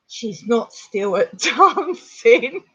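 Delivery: slow, laughing as she imitates her parents; laughing through it. Parents realise they forgot her at dancing, slow and laughing. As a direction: let the laugh break through the quote.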